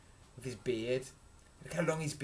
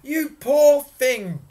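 high, very loud, slow exclamation; booming giantess; 12 semitones higher, 17 dB louder, much slower than usual. A narrator's reading. Giantess pitying little Jack, booming. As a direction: boom it out with huge sympathy. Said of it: "boomed the giantess".

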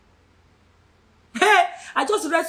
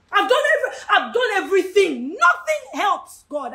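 high cry slowing on the last words; acted desperate complaint; despairing outburst; 4 semitones higher than usual. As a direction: cry out in helpless frustration. Acts out a desperate believer.

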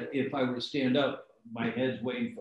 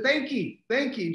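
quick high polite brush-off, never breaking stride; Orville Redenbacher; 14 semitones higher, 4 dB louder than usual. Retold or imitated. Celebrity thanking a passing fan, breezy and high. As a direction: toss off thanks while walking past. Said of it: "He said".

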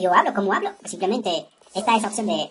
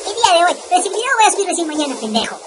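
exasperated; 6 semitones higher, 4 dB louder than usual. Real, not acted. Scolding himself over the intro.

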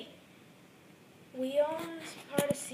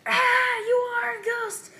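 higher and much louder mock shriek; terrified scream; 8 semitones higher, 12 dB louder, slower than usual. Scared toy shrieks at the robot-arm ghost. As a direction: scream in hammed-up fright.

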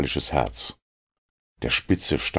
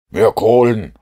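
higher, much louder shout; 6 semitones higher, 9 dB louder than usual. A narrator's reading. Holmes shouts into the engine room for more coal. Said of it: "schrie Holmes".

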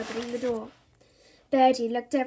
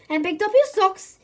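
high, loud frantic mother; fussy mum voice; 7 semitones higher, 8 dB louder than usual. Acted. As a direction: fuss at him like a harried mum. Mum nagging before viewing.

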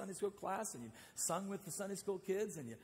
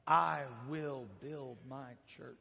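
Jesus speaking; 5 semitones lower, 3 dB louder, slower than usual. Acted. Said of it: "hear Jesus say the words".

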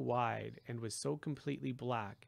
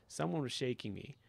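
ordinary delivery, nothing marked

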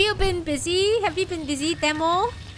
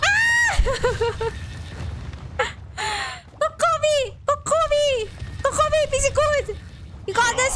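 frantic high cheering; panicking gamer; 7 semitones higher than usual. Real, not acted. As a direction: scream encouragement at your teammate. Cheering teammate on.

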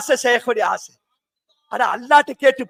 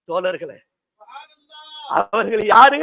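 ordinary talk, nothing marked

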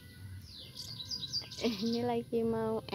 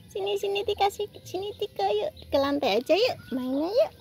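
higher sing-song coaxing with rising yuk; coaxing pet talk; 8 semitones higher, 4 dB louder than usual. Acted. Urging her squirrels to climb the tree, higher sing-song coax. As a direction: coax them up like a fond owner.

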